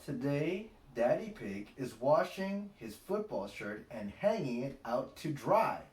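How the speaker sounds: ordinary delivery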